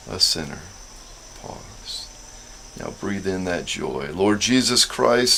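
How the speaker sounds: slow guided Jesus prayer; slow breath prayer. Shown as a demonstration. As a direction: lead the prayer slowly, breath by breath. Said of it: "Let's say our, our Jesus prayer together".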